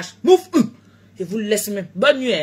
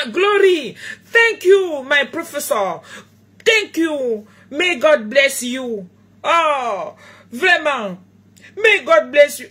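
put-on higher, laughing thanks in French-accented English; playful grateful thanks in French-accented English; gushing broken English; 4 semitones higher, 4 dB louder, slower than usual. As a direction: thank the imagined professor with delight. Thanking imagined English professor.

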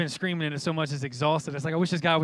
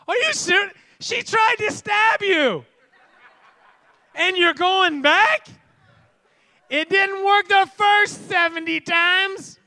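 much higher, loud, slow disbelief; incredulous disbelief; 13 semitones higher, 9 dB louder, slower than usual. Acted. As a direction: drag out the outrage. Incredulous mock response.

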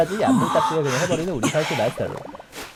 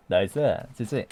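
ordinary talk

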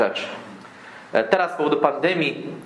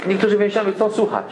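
normal talking voice

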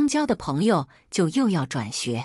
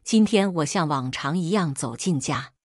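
ordinary delivery, nothing marked

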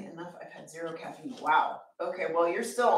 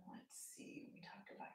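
whispering; 25 dB quieter than usual. Real, not acted. Reading chat aloud to herself.

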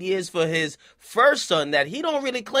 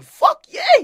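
much higher and louder whoop; excited cheer; 9 dB louder than usual. Pretend. Voicing a viewer cheering the rapper on, loud whoop.

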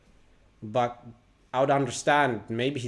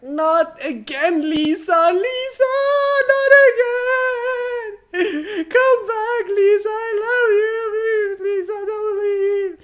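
hammed-up wailing, much higher and louder, drawn out; mock sobbing plea; 9 dB louder, slower than usual. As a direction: overact a heartbroken man begging his girlfriend not to leave, crying. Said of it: "he's just overwhelmed with emotions".

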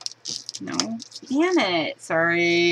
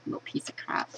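ordinary delivery

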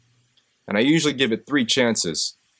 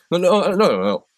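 loud, higher no's that drop at the end; louder retold shutdown; firm refusal; 8 semitones higher, 4 dB louder than usual. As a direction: cut him off hard. Confronting heckler in front of crowd.

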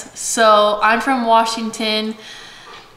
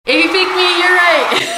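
playful teasing; 9 semitones higher, 6 dB louder than usual. Real, not acted. Teasing viewers about who spoke first.

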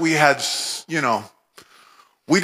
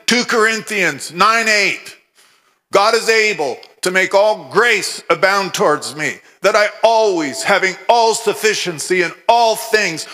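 higher, forceful proclamation of the verse; fervent spoken declaration, pitched up; declaring scripture; 3 semitones higher than usual. Retold or imitated. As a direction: declare it in the face of need. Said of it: "declaring in the face of our need".

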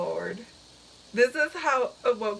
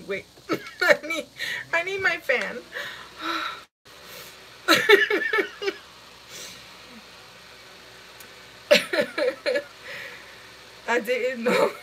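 usual speaking voice

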